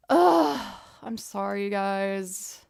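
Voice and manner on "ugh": loud, high exasperated ugh; frustrated groan. Real, not acted. Streamer fed up with her mic peaking, loud groan. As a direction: let out a sharp, loud groan of annoyance.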